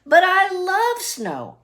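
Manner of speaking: high, slow, eager insistence; insisting. Acted. Irene pleads with her sick mother, high and insistent. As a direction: plead it brightly, drawing it out. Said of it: "Irene insisted".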